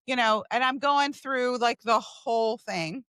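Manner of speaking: usual speaking voice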